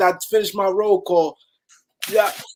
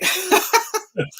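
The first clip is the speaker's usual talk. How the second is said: high burst of laughter; 11 semitones higher than usual. Real, not acted. Father laughing about his son.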